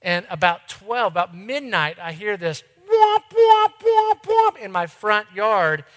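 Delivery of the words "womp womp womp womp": loud, high-pitched car alarm whoops; mimicked alarm going off, high and loud; car alarm imitation. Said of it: "the alarm is waking up".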